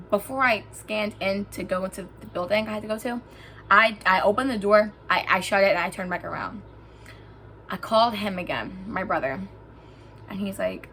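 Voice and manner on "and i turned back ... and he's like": ordinary talk, nothing marked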